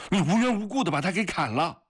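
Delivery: fast, very high outraged cry; 格里高利, angry shouting. A narrator's reading. Soldier accuses the guard of killing the prisoner, shouting. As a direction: hurl the accusation at him. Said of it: "突然大声地喊了起来".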